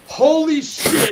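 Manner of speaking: high, slow, cracking disbelief; exasperated outburst. Real, not acted. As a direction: cry it out in disbelief. Exasperated reaction to troll.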